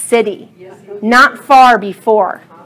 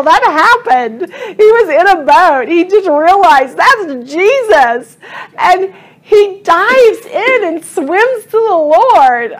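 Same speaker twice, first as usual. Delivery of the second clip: choked up, pushing the words out through real crying; tearful, crying; 6 semitones higher, 4 dB louder than usual. Real, not acted. Tearful preacher telling the congregation about Peter's love. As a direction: voice breaking with tears while preaching.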